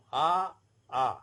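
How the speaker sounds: both A and a said as the same open "ah"; identical vowel sound. Shown as a demonstration. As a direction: say A and a alike, as French "ah". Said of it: "pronunciation is same".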